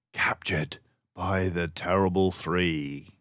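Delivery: usual speaking voice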